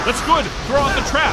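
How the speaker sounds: high, loud excited calls; shouting orders. Acted. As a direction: urge the partner on mid-battle. Fight, calling to partner.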